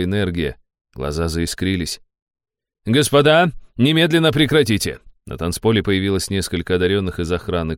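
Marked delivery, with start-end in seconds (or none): 2.8-5.0 shouted order to stop; loud command. A narrator's reading. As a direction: call out firmly over music. Guards call out order.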